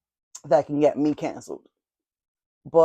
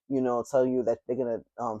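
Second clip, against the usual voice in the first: normal talking voice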